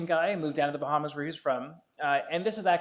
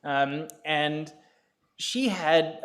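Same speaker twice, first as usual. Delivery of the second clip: ordinary talk, nothing marked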